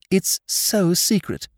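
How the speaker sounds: high, slow secretive tone; conspiratorial. Acted. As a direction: lean in and confide it slowly. Tarrant whispers conspiratorially.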